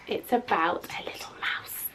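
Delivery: soft secret whisper; hushed reveal. Acted.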